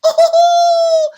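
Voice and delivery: high loud laughing oh; delighted laughing cry. Acted. Old man laughing with joy at the money. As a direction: burst out with joy.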